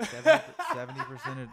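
breaking into a laugh; high laughing squeal. Real, not acted. Laughing restart of a line.

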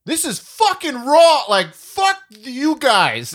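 loud, high, slowed mock rage; angry outburst. Friend mocking the movie's angry actor, loud slow outburst. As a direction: bellow it like the actor losing it. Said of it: "the scene where he's like".

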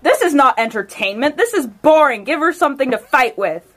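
loud, indignant call from the stands; shouting. Acted. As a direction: shout it across the arena. Her character shouts to the crowd.

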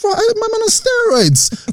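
slow, very high astonished voice; amazed high squeal. Retold or imitated. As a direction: gasp it out in disbelief. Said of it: "You're like".

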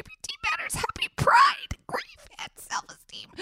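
whispered, voice giving out; losing my voice, just a whisper; raspy whisper. Real, not acted. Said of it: "Sorry, I'm losing my voice".

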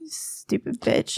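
whispered, slow insult; muttered aside. Real, not acted. About the one who stole the Power Pad, whispered jibe. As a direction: drop to a slow, voiceless mutter under the breath.

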